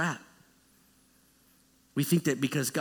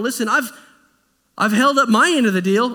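louder, higher accusing complaint; indignant Moses; 5 semitones higher, 7 dB louder than usual. Acted. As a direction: push back hard, you kept your side.

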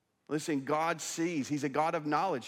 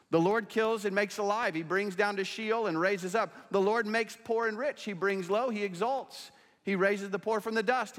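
raised, ringing Bible reading; declaimed scripture reading; 6 semitones higher than usual. Real, not acted. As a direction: proclaim the verses with mounting conviction.

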